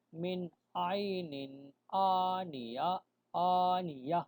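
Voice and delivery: آنیہ said slowly and held at the pause; slow chant ending on آنیہ; stop on آنیہ. Shown as a demonstration. Teacher showing where to stop, slow chant. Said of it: "وقف کرنا".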